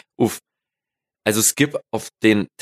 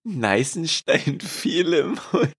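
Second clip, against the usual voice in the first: incredulous; 6 semitones higher, much slower than usual. Real, not acted. Rereads a garbled phrase in disbelief.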